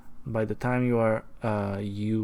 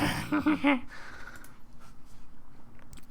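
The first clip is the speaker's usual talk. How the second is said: very high, whispery giggle; airy falsetto titter; high breathy giggle; 5 dB quieter than usual. Acted. Breathy high man laughs after.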